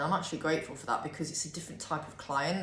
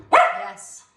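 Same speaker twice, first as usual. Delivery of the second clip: much higher and louder yes; 13 semitones higher, 15 dB louder than usual. Real, not acted. Squealing yes at the dog in baby talk. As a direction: coo it up high at the dog.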